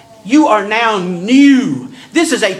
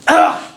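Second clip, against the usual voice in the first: disgusted ugh; 8 semitones higher than usual. Retold or imitated. Paul recoiling from the idea of sinning more, disgusted groan. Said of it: "closest English translation".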